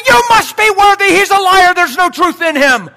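loud, ringing preacher's declaration; full-force preaching. Acted. Pastor declaring to the church, loud. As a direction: hammer it home from the pulpit.

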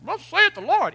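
high, loud mock proclamation; pompous prophet. Preacher contrasting humble speech with pompous prophecy, hammed-up. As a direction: puff it up like a grand oracle. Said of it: "don't be all like".

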